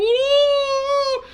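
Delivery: very high, slow, loud wailing sob; mock boo-hoo crying. Mocking Square crying over the missing dog, wailing sob. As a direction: wail like a hammed-up crybaby over a lost dog. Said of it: "he was crying just a boo-hoo".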